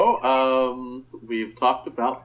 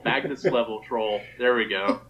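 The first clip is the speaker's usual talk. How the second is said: normal talking voice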